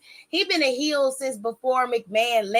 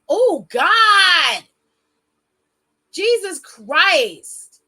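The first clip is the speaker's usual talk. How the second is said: loud, high, drawn-out "Jesus Christ"; fed up with yourself, slowly; exasperated groan; 6 semitones higher, 7 dB louder, much slower than usual. Real, not acted. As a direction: roll your eyes at your own mistake, out loud. Exasperated at own stumbling.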